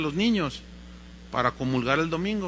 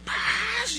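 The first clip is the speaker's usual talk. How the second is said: high, drawn-out smack noise; cartoonish whack; imitated slap. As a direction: voice the slap landing.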